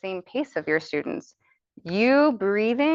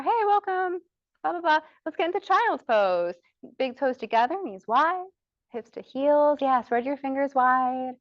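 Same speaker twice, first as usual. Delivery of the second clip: bright, higher yoga-teacher cueing; 6 semitones higher than usual. Shown as a demonstration. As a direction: cue them into child's pose like in class. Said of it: "three things for you to say".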